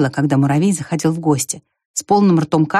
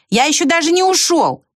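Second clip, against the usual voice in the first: high-pitched protest, mild shout; calling back; 11 semitones higher than usual. A narrator's reading. Ant answers the squirrel while leaving, calling back.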